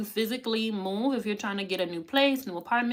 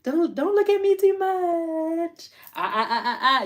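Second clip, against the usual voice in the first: hammed-up shy celebrity; mock coy diva; 8 semitones higher, 6 dB louder than usual. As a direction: imitate someone fishing for attention. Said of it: "You, you be like".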